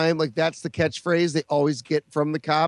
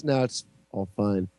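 normal talking voice

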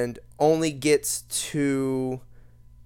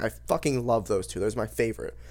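ordinary talk, nothing marked

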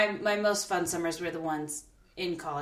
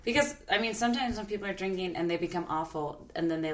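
ordinary talk

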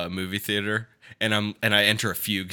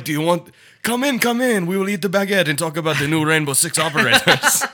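fake French accent; 5 semitones higher, 5 dB louder than usual. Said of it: "puts on a fake French accent".

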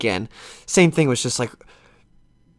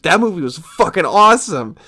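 excited; 4 semitones higher, 5 dB louder than usual. Retold or imitated. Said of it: "And I was like".

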